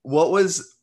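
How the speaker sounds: normal talking voice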